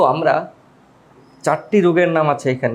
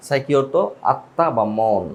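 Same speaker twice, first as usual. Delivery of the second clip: usual speaking voice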